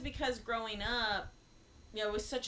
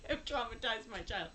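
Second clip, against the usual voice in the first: ordinary talk, nothing marked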